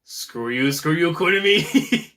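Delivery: mock taunt. Mock angry taunt at character.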